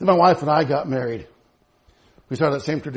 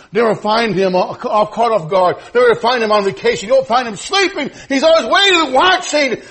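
raised, mounting pulpit delivery; forceful, rhythmic sermon assurance; impassioned preaching; 10 semitones higher, 8 dB louder than usual. Acted. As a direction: build each repetition toward a loud peak.

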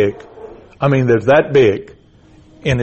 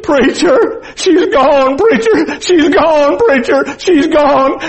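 very high, loud cries of grief; screamed, imitated despair; anguished wailing; 14 semitones higher, 5 dB louder, much slower than usual. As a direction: wail it like a father who lost his child. Grieving father wails that his child died.

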